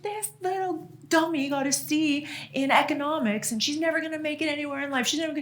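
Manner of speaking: high, fast mock tirade; mock mom rant. Mom ranting at dad about daughter's grade, high and fast. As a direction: rattle off mom's rant high and quick.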